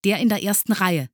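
high, loud, dutiful report; pupil reporting. A narrator's reading. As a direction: report up eagerly like a model pupil. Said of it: "meldete er". (eifrig meldender Schüler)

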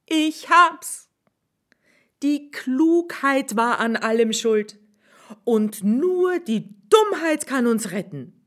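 a sudden bright idea; high swineherd, triumphant; swineherd's voice. Acted.

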